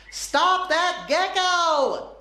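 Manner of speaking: high, drawn-out storybook yell; mock yell. A narrator's reading. Annie to friends as the gecko escapes, high yell. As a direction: call out high, stretching the words. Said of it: "Annie yelled".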